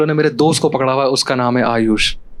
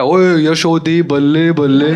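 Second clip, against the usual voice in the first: slow, stretched Punjabi-style cry of ओए यशोदी बल्ले बल्ले, a bit louder and laughing; angry Sikh shopkeeper; 4 semitones higher, 3 dB louder, much slower than usual. Retold or imitated. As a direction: drag out ओए like a furious sardar cheering bhangra. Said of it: "उसे गुस्सा आ गया".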